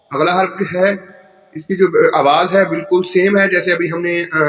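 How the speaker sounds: ordinary talk, nothing marked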